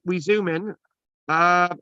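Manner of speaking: ordinary talk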